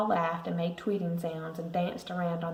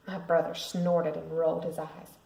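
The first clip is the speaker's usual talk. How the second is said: ordinary delivery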